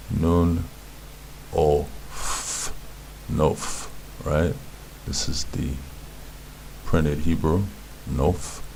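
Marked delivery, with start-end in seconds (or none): 0.1-3.9 "Nun", "o", "f" separated by pauses, then joined as "Nof"; sounding out. Shown as a demonstration. Teacher spells the word sound by sound, sounding out. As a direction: break "o f" apart with a long f, then blend "Nof". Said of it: "This word is pronounced".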